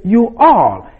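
high-pitched, falling "You all"; emphatic YOU ALL. Shown as a demonstration. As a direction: make "You all" stand out by raising the pitch. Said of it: "that it is emphatic".

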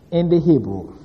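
ordinary talk

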